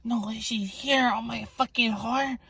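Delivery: high, slow mocking women's voice; mocking the fat ladies. Vlogger mocks the bleacher ladies' gasp, high and slow. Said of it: "They go".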